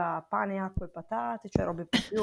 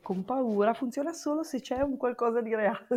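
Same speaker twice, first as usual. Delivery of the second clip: normal talking voice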